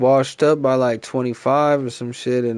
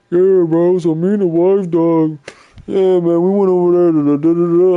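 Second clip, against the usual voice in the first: higher and louder, mocking a guy rambling about his wife; mock chatty player; 5 semitones higher, 7 dB louder than usual. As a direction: overplay the oblivious storyteller mid-match. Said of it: "talk about some bullshit in there".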